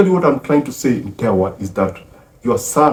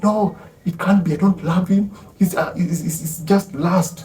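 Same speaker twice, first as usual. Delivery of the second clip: flustered denial; 8 semitones higher than usual. Retold or imitated. Woman denies love under threat.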